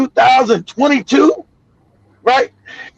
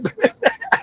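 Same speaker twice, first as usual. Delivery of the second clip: a high squealing laugh; short high laugh; 6 dB quieter than usual. Real, not acted. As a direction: burst out laughing.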